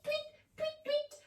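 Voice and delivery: tiny high soft tweets; bird tweets. Shown as a demonstration. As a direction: chirp softly like a little bird. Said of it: "go tweet".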